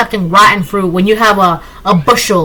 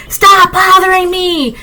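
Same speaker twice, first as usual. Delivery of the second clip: raised voice; 9 semitones higher, 3 dB louder than usual. Retold or imitated. To a harassing woman in a dream, a loud raised voice. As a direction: stand your ground and make the whole crowd turn. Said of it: "And I raised my voice".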